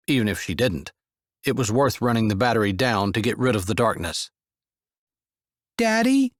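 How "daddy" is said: lightly voiced young child, high; a little kid calling for daddy in the dark; small child calling. A narrator's reading. Child voice in story.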